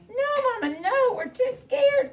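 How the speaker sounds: high, pleading no; scared little ducklings. Acted. Ducklings refusing Mama, high scared plea. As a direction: whine like a frightened duckling. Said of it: "cried Piddle, Petal, and Little Quack".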